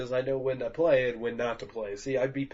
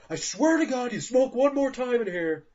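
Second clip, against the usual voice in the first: angry; 10 semitones higher, 4 dB louder than usual. Pretend. Scolding the pig.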